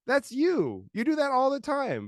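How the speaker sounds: high-pitched, loud exasperated retort; mock lecture to his toddler, pitched high; exasperated dad. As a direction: pitch up, calling the kid out.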